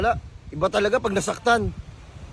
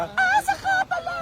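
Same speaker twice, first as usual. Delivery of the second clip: high cracked squeal on "Paasa"; squeaky falsetto. Acted. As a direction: leap way up into falsetto on "Paasa ka pala".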